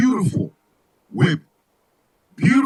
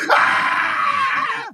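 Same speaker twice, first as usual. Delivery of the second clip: high yelp; 14 semitones higher than usual. Acted. Comic cutting off talk before the song, sudden high yelp. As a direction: let out a sudden high-pitched cry. Startled yell in song bit.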